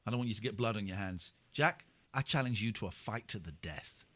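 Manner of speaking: low, hushed mock menace; understated mock-heroic gravity; mock-solemn challenge. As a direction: gravely throw down a pretend duel. Mock duel challenge to caller.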